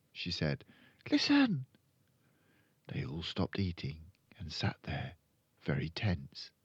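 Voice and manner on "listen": put-on high little-girl voice; high, urgent girl character; hushing Matilda. A narrator's reading. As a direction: urge them to listen as a small girl. Matilda hushes the family.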